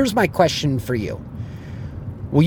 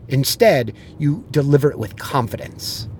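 ordinary talk, nothing marked

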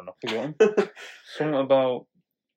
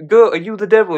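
loud, higher-pitched playful accusation; mock interrogation; 6 semitones higher, 9 dB louder than usual. Villagers asking a goat about the devil, mock accusing. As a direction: demand it of the goat in a raised voice.

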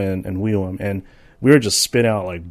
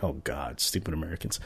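low, much softer disdainful French woman; annoyed French woman; 5 semitones lower, 13 dB quieter than usual. Retold or imitated. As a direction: sneer quietly like an unimpressed Parisian. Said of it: "she'll probably be like".

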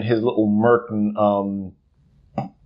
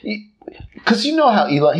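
ordinary delivery

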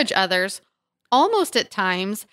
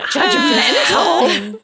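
mocking drawl; 7 semitones higher, 6 dB louder, much slower than usual. Mocking the word judgmental.

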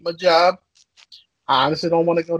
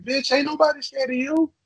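much higher, scornful retort; defiant comeback; 11 semitones higher than usual. Retold or imitated. Telling the shopper how he answered, scornful and high. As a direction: toss it back with contempt. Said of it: "I was like".

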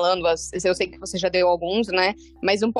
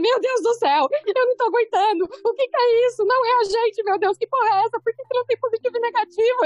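freaking out. Retold or imitated. Friend panicking over a HIV result, high mock panic. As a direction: retell a friend's freak-out in a squeal. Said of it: "me ligou quase surtando".

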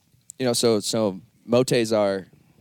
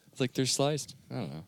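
ordinary talk

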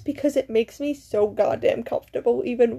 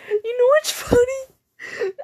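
laughing; 10 semitones higher, slower than usual. Real, not acted. Laughing while starting a new story.

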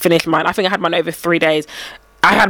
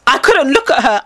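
usual speaking voice

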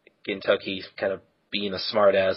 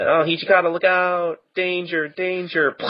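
high, hammed-up warning cry; a high mock panic yell; mock alarm; 9 semitones higher than usual. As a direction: squeal the warning like a panicked extra. Random guy warning of danger.

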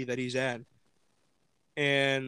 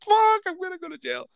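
mock panicked wail; 4 dB louder than usual. Driver suspected by the cop, mock panicked wail. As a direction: wail it high, playing up the dread. Acted panic about jail.

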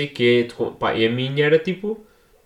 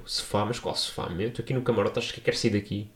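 baffled mutter; 3 semitones lower, 8 dB quieter than usual. Retold or imitated. Puzzled answer to league staff.

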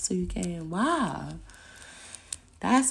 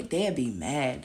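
ordinary talk

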